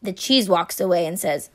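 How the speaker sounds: plain reading voice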